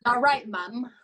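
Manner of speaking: imitating a son's northern English greeting; lightly put-on northern English accent. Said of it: "my brother with his northern English accent".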